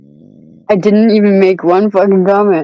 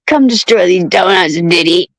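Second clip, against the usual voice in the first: ordinary talk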